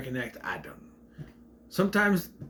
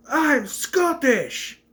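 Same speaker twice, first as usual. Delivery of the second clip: high, drawn-out hammed-up Scottish cry; mock Scottish; 11 semitones higher, 4 dB louder, much slower than usual. Scottish character answering what he does, high exaggerated Scottish accent. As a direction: stretch out a comically proud Scottish accent. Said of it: "who's just randomly Scottish".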